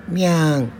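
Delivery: high meowing cat sound; a cat at their feet meows in reply. Acted. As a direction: meow back like a little cat. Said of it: "ねねこが足元で、返事を".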